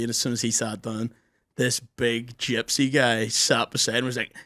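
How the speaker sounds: ordinary talk, nothing marked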